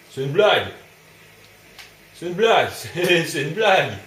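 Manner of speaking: loud, high repeated cry of disbelief; panicked disbelief. Real, not acted. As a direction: yell it in frantic panic. Panicking under enemy attack.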